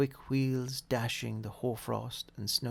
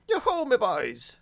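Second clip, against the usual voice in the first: jovial call; 10 semitones higher, 8 dB louder than usual. A narrator's reading. Fezziwig calling out.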